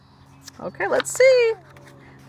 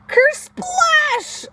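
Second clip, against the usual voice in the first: very high, loud splash word; loud high sound-effect; 13 semitones higher, 5 dB louder than usual. A narrator's reading. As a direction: shout the splash as it sinks.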